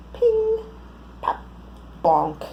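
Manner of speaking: ordinary delivery, nothing marked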